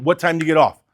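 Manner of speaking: ordinary talk, nothing marked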